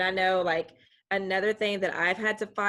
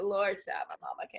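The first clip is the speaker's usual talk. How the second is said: exasperated aside; 5 dB quieter than usual. Retold or imitated. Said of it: "I was like".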